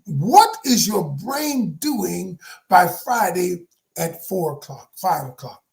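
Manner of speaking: normal talking voice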